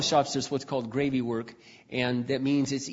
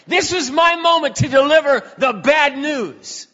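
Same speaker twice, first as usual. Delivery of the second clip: loud, slow, high proclamation; shouted conviction; 11 semitones higher, 10 dB louder than usual. Real, not acted. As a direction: proclaim it like a preacher at full force.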